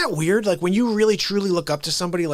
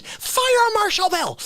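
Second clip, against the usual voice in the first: Fire Marshal Bill voice; slower than usual. Retold or imitated. Recalling Carrey character.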